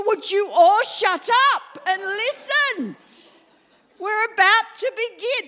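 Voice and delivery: loud, high shout over a chattering crowd; calling the room to order. Retold or imitated. Host shouting to a noisy happy room, loud high call.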